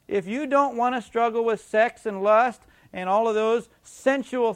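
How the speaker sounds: loud, high pulpit preaching. Acted. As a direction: preach it with force. Preaching at full force.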